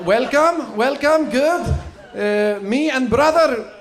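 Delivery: put-on high, halting foreign-accented English; broken English in a hammed-up accent, high. Performer introducing brother to family, put-on foreign accent. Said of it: "ethnic it up every time".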